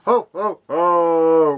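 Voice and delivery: booming Santa Claus voice; jolly ho ho ho. Pretend. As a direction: play a hearty Father Christmas, slowed. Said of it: "what am I on about?".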